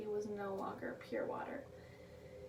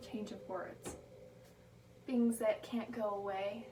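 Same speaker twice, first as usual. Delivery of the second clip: ordinary delivery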